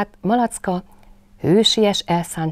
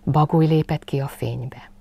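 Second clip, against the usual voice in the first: ordinary delivery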